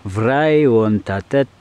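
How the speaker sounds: slow, careful Romanian with a heavy French accent; copying an app's Romanian with a French accent. Shown as a demonstration. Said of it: "Avec mon accent de merde".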